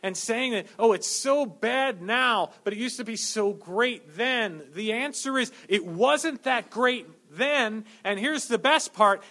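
loud, rising sermon delivery; fervent preaching. Acted. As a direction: preach it with building fire. Preaching at full force.

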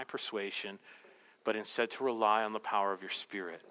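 soft, low, reverent prayer; closing prayer. Acted. Pastor praying for the church, soft and reverent. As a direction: pray gently over the congregation.

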